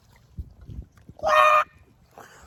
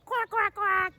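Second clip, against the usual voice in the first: quieter quack, quack, quack; softer duck quacks; 3 semitones higher, 6 dB quieter, slower than usual. Pretend. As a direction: quack again softly like a duck.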